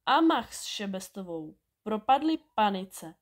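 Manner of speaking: ordinary delivery, nothing marked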